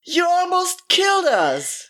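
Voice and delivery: high, slow outraged shout; indignant yell. Acted. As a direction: fire the accusation back. Shouting back at hunter.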